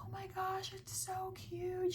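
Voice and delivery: high, slow, breathy-quiet gush; high, slow adoring coo. Retold or imitated. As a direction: coo over it like it's adorable. Customer gushing over empanada.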